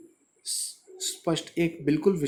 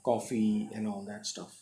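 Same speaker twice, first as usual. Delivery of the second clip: very slow and softer; slow, soft reading; 4 semitones lower, 5 dB quieter, much slower than usual. Shown as a demonstration. Teacher reading the phrase slowly to the class. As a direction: read it out slowly and gently. Teacher reads text aloud.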